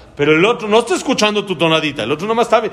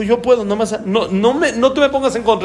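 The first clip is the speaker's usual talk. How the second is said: excited bragging, higher; carried away boast; 6 semitones higher than usual. Retold or imitated. General, carried away by his power, boasting. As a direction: swell with pride. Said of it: "se emocionó tanto que él dijo".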